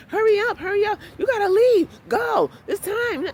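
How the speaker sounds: high, loud mock yell to hurry; woman yelling. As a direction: bark them out the door, imitating. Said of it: "kept yelling at me".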